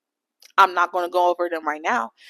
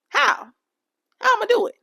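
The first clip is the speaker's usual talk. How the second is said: much higher, incredulous how; exasperated high; 12 semitones higher than usual. Real, not acted. Complaining about texts with no emojis, high incredulous pleading. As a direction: throw up your hands in pitch.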